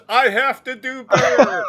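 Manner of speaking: high, loud mock proclamation; hammed-up high-pitched quote; mock sign reading. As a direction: squeal out the slogan into a laugh. The sign on the door says.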